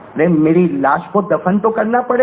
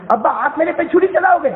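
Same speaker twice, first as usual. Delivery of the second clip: put-on pleading child; 7 semitones higher than usual. Son questions father.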